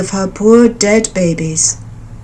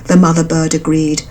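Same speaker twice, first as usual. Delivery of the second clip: ordinary delivery, nothing marked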